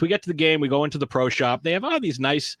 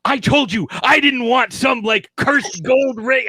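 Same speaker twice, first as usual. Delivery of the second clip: higher, louder, slow scolding; mock angry wife; 9 semitones higher, 5 dB louder, slower than usual. Girlfriend rejecting the cursed ring, mock scolding. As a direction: lay into him for the wrong ring. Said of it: "She goes".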